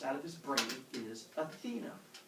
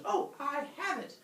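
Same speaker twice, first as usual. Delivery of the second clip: high-pitched mock eureka; sudden bright idea; 13 semitones higher than usual. She announces her winning idea to Zeus, high and excited. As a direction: act out hitting on the winning trick.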